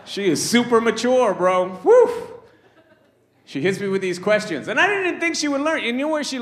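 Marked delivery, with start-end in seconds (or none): 1.8-2.3 high, loud whoop; excited whoop. Real, not acted. Comic hyping up the crowd with a whoop. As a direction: let out a loud cheer.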